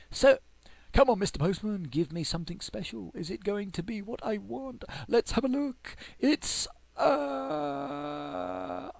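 excited plea to the postman for a special prize; eager urging. Real, not acted. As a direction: egg the delivery on like a game show.

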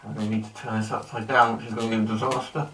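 speaking inside a respirator; talking behind mask visor; through a gas mask. Real, not acted. Seen 1: Speaker wears full gas mask throughout, drinking from bottle tube.

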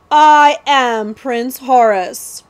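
loud, slow, grand announcement; royal proclamation. A narrator's reading. Prince reveals himself to outlaws, grand proclamation. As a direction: proclaim the name word by word.

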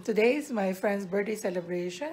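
ordinary delivery

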